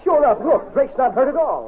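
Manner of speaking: high-pitched, surprised exclamation; startled discovery. Acted. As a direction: call out what you just found. Discovers the wounds were faked.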